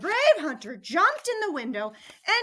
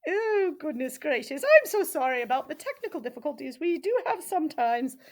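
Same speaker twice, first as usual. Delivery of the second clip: plain reading voice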